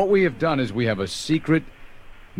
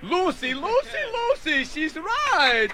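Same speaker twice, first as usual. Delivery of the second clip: Ricky Ricardo; slower than usual. Retold or imitated. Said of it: "[name] is Ricky Ricardo".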